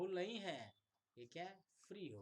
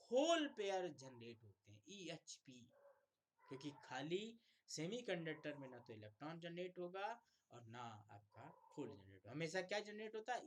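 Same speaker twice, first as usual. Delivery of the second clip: ordinary talk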